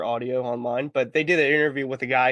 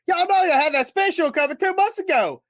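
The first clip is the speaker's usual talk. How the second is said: high, fast complaining customer; imitated angry customer; 4 dB louder than usual. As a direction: vent at the drive-through speaker. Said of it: "It's like".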